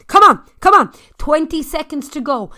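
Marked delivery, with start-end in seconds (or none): 0.1-0.9 high, loud come on; rally them hard; urging shout. Real, not acted. Spin coach shouting riders on.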